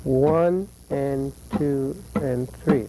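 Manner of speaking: very slow count, one and two and three; slow, even counting; slow demo count. Shown as a demonstration. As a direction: count slowly, beat by beat, for the students. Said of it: "let me explain that further".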